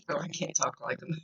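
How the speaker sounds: usual speaking voice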